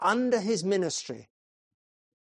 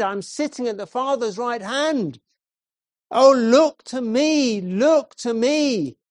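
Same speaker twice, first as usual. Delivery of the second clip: fervent preaching; 5 semitones higher, 5 dB louder than usual. Acted. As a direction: lay preacher crying out to the congregation, rising fervor. Preacher pleading crescendo.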